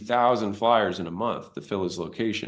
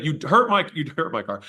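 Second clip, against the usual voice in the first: higher, louder indignant complaint; mock angry caller; 6 semitones higher, 4 dB louder than usual. Caller to the gym owner, mock indignant. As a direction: imitate an offended stranger.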